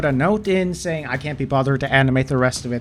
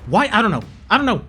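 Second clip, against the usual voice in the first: angry rant; 5 semitones higher than usual. Real, not acted. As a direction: rant in fury, exploding on the last words. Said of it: "That's the end of the rant".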